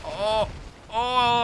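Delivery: usual speaking voice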